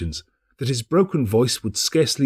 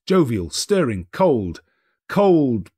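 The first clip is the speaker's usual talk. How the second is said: ordinary delivery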